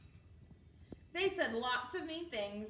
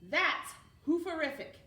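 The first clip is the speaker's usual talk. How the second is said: excited; 7 semitones higher, 7 dB louder than usual. Acted. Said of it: "Cornelius said".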